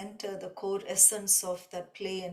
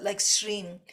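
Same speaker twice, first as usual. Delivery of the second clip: normal talking voice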